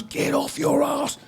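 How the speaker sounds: high, slow mock punk yell. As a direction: drag out a sneering punk shout. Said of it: "[name] just goes".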